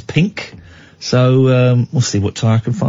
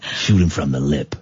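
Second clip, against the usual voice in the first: low, softer catchphrase voice; mock quote; 12 semitones lower, 5 dB quieter than usual. Host repeating someone's catchphrase, low mock quote. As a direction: drop the pitch right down for the quote.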